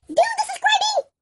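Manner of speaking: very high squeaky chirp; squeaky character voice. Pretend. Twin butting in to ask for subscribers, squeaky. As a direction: squeak it out in a tiny voice.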